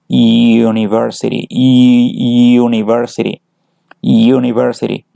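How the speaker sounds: "university" with the opening y drawn out and punched; slow "university", its first y sound held like a consonant; stressed opening y sound. Shown as a demonstration. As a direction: lean hard on the "yoo" that starts "university". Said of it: "es un sonido de una consonante".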